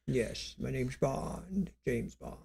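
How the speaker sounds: slow, soft creaky Bond line; Sean Connery. Retold or imitated. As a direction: croak it out like a suave old spy. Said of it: "listen to Sean Connery".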